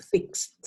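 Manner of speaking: "Fixed" pronounced "fikst"; careful model "Fixed", closing on T; T at the end. Shown as a demonstration. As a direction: say "Fixed" in one syllable with an audible final T. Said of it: "con esa T al final".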